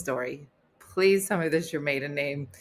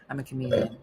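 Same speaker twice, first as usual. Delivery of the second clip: low, soft deadpan; dead serious; 5 semitones lower, 5 dB quieter, faster than usual. Shown as a demonstration. Comedian showing how she tells doctors her job, deadpan. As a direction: drop low and soft, utterly straight. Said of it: "I say it just like that".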